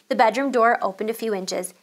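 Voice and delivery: ordinary delivery, nothing marked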